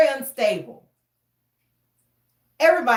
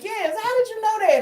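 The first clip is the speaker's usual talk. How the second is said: surprised coworker; 11 semitones higher than usual. Retold or imitated. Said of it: "And he was like".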